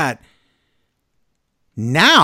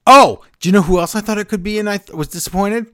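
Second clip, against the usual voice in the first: excited; 6 semitones higher, 4 dB louder, faster than usual. Real, not acted. Eager question to co-host.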